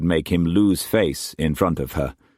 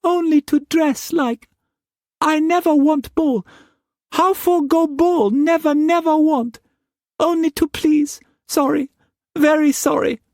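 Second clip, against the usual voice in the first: put-on very high, pleading girlish voice; pleading young woman; 3 dB louder than usual. A narrator's reading. Mei Mei begging Struan not to whip hard, high frightened pleading. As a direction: beg him, small and frightened.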